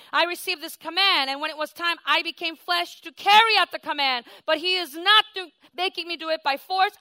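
voicing Jesus in a loud, ringing sermon; full-force preaching. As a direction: proclaim it from the pulpit.

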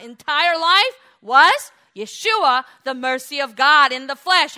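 loud, high, exultant preacher; ringing pulpit shout; forceful preaching. Real, not acted. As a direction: proclaim it at full force.

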